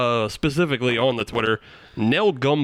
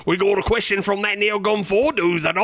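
high, hammed-up Cockney accent; silly pitched-up Cockney; mock Cockney; 7 semitones higher than usual. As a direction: exaggerate a comic Cockney voice. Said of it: "if I was saying it funny".

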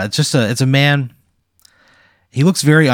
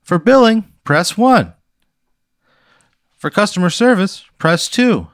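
phone menu voice; 10 semitones higher, 4 dB louder than usual. Acted. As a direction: read each option like an automated menu. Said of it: "it's a recording".

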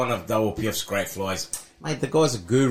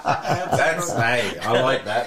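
laughing; slower than usual. Real, not acted. Still laughing at the joke.

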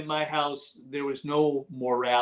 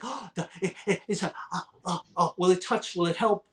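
worried; 4 semitones higher, 3 dB quieter, faster than usual. Retold or imitated. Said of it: "I'm going like, gasps".

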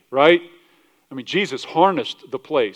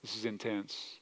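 hushed, much softer awe; awed hush; 5 semitones lower, 15 dB quieter, slower than usual. Retold or imitated. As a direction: murmur it, overwhelmed. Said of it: "people would have been like".

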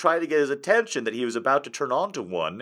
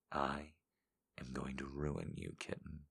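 seductive voice; 8 semitones lower, 17 dB quieter than usual. Pretend. Reading a viewer quote in a low sultry voice. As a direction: purr it low and intimate. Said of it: "I say it".

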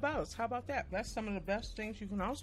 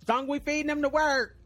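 loud and cheeky; louder, grinning comeback; loud playful retort; 4 semitones higher, 7 dB louder than usual. Real, not acted. Defending eating on mic.

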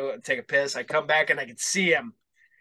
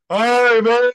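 put-on drunk guy greeting; 8 semitones higher, 10 dB louder than usual. Imitating drunk guy at concert.

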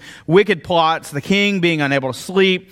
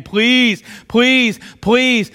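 begging; 7 semitones higher, 3 dB louder, much slower than usual. Retold or imitated. Haman pleading, voiced in sermon.

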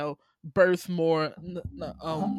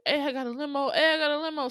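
higher, eager repeated hey; hyped movie character; 8 semitones higher, much faster than usual. Retold or imitated. Uncle shouting to nephew about his limo, eager. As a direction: play the uncle bursting in with a limo. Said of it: "But he's like".

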